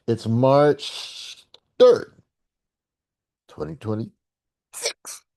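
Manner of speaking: slow, then drops to a whisper; dramatic date reading. Acted. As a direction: hush the year like a secret. Announcing date in dramatic hush.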